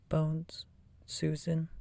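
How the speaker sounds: ordinary delivery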